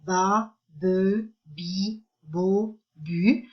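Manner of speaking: "ba, be, bi, bo, bu" each said as a separate syllable with a gap after it; teacher's syllable drill, "ba, be, bi, bo, bu" each set apart; isolated syllables. Shown as a demonstration. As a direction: sound out "ba, be, bi, bo, bu" one syllable at a time, evenly spaced. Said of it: "vous les avez avec les syllabes".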